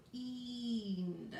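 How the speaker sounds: Cleaned drawn out sound by sound; teacher segmenting a word aloud; stretched out. Shown as a demonstration. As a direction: slowly stretch Cleaned for young readers. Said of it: "stretch it out".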